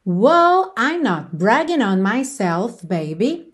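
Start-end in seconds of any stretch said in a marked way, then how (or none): none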